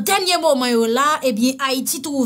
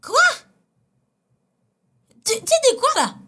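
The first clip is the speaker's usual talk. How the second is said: shocked; 10 semitones higher than usual. Real, not acted. Shocked high question.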